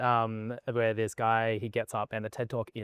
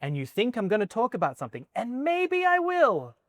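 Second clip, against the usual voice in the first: building up; 7 semitones higher than usual. Retold or imitated. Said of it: "continues like, I'm gonna build up".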